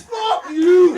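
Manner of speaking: high, loud mock outburst; hammed-up hostile shout; imitated angry yell. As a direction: yell it like an outraged stranger. Imitates angry stranger yelling at him.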